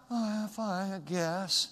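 the wrong way to answer "How you doing?"; mopey answer. Shown as a demonstration. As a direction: drag out a half-hearted "fine". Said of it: "Get rid of that stuff".

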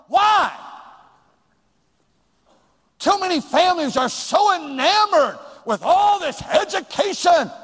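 loud, high sermon shout; full-force preacher's cry; fiery preaching. Acted. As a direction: preach it hard to the pews.

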